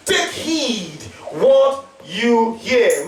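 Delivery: slow, drawn-out 'Take heed what you hear'; solemn scripture reading. Acted. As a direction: read each word slowly. Repeats verse very slowly.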